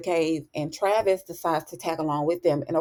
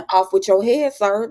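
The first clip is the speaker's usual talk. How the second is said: mock loud proclamation; 4 semitones higher, 6 dB louder than usual. Mock executioner's pronouncement.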